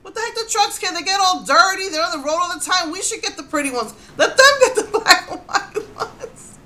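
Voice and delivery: high, animated mock outrage that breaks into a laugh; indignant rant. Real, not acted. Ranting about trucks getting the pretty plates.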